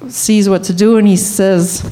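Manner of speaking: ordinary delivery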